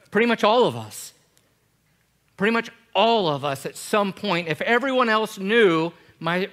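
loud, high, driving sermon; preaching at full force. Acted. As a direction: build the congregation up with fervour.

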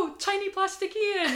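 high, laughing mock despair; mock dismay. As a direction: gasp out a playful lament. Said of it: "You went".

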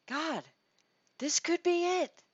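a sudden flash of hope; hopeful Mary. Retold or imitated. Mary's hopeful inner thought.

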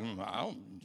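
low, soft noncommittal mumble; evasive soldiers. Acted. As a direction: dodge the question under your breath. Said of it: "And they're like".

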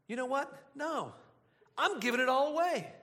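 high-pitched, acted-out realization; decide it on the spot, eagerly; sudden resolve. Acting out Zacchaeus's sudden decision.

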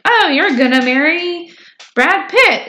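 high-pitched, playful pretend prediction; mock fortune reveal. Acting out cootie catcher's fortune.

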